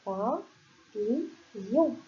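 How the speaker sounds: papillon stretched out syllable by syllable; slow teaching read-out. Shown as a demonstration. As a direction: sound out Papillon very slowly for children. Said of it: "papillon va s'écrire de cette façon".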